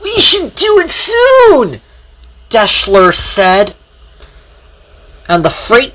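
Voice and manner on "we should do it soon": high eager shout. Acted. Engine calling out keenly to her partner. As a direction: call it out, keen to go.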